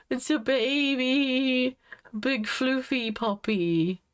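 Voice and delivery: high, slow, doting coo. Real, not acted. Streamer doting on a big floofy puppy, cooing.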